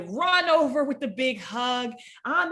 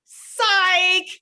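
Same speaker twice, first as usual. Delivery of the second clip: louder, higher drawn-out call; gleeful prank reveal; 5 semitones higher, 7 dB louder than usual. Retold or imitated. Sister revealing her prank to the family, gleeful prank reveal. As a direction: re-enact springing the prank on everyone. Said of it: "she goes".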